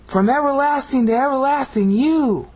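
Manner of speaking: high, ringing, sustained declamation; dramatic proclamation. Shown as a demonstration. Teacher translating the Greek for students, dramatic. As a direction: let the phrase soar to the final word. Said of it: "It's dramatic".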